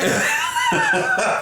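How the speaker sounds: loud, high burst of laughter; laughing. Real, not acted. Baker laughs at the granny and nanny muddle, high laughter.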